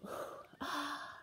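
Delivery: sharp breathy gasp of shock; dramatic gasp. Acted. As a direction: gasp in mock astonishment. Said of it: "As everybody gasps".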